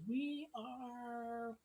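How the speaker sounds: cheery high voice greeting guests at the door; half-elf maiden. Acted. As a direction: lift way up into a chirpy maiden. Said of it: "She goes".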